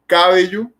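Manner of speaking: cabello mis-stressed on the first syllable, CÁ-bello; wrong stress. Shown as a demonstration. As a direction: hit the ca- of cabello. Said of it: "No decimos cabello".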